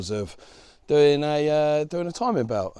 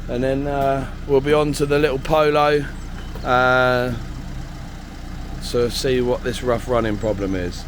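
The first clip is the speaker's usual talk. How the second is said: louder, talking over engine noise; raised voice; 2 semitones higher, 5 dB louder than usual. Real, not acted.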